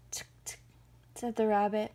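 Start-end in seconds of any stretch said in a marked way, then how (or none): 0.1-0.6 soft double tongue click; quiet tsk-tsk; tutting. A narrator's reading. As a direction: tut twice in mock concern. Rabbit tuts at the bear.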